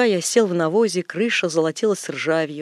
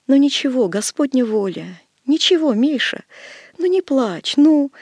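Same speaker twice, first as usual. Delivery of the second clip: comforting; 7 semitones higher, 5 dB louder than usual. Acted. Old woman comforting weeping man.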